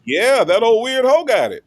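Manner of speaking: teasing the member who got the gift; mock-scornful tease. Real, not acted. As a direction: rib the gifter playfully.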